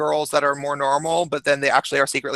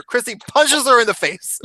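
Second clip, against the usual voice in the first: normal talking voice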